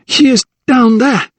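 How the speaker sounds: high, pressing shout; urgent cry. A narrator's reading. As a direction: point him to the professor. Lydia urging in the fire.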